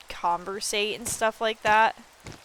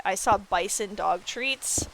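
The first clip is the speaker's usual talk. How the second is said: usual speaking voice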